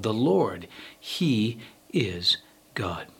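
ordinary delivery

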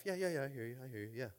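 lightly acted "uh-huh" to a wife; half-listening husband. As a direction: tune out while pretending to listen. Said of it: "like me when I'm talking to my wife".

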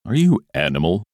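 loud yawns between the words; yawning. A narrator's reading. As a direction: yawn through the question, word by word. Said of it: "yawning at every other word".

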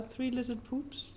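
usual speaking voice

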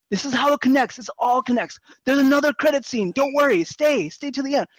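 high, loud mock lecture to the room; know-it-all guy. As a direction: play the annoying fan explaining the plot. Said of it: "he just keeps on saying".

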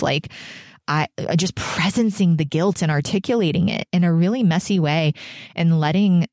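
usual speaking voice

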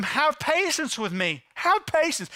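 plea pitched high; imploring. Retold or imitated. Servant begging his master for patience, imploring. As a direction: beg for patience on your knees. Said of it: "he implores him".

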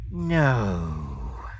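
low, drawn-out, sighing refusal; long sighed reply. A narrator's reading. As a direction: let the word sink out on a sigh. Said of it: "I sighed to the end of my breath and replied".

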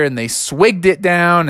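usual speaking voice

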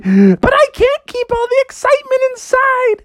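very high falsetto, welling into tears; hammed-up overjoyed wail; happy-tears Froggy. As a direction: squeal it, breaking into a joyful cry. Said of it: "started to cry happy tears".

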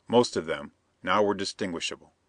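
usual speaking voice

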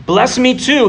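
high, desperate pleading cry; begging. Retold or imitated. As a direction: throw the plea up high, as if Esav is desperate. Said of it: "he begged".

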